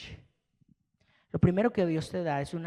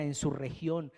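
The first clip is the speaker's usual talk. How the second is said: ordinary talk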